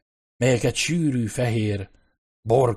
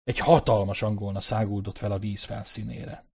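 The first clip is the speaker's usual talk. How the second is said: usual speaking voice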